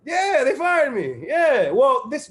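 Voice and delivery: high, loud, amused disbelief; excited. Real, not acted. Recounting being fired, laughing.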